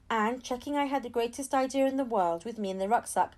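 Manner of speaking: ordinary delivery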